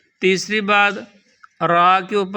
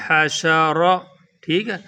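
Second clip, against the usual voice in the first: ordinary delivery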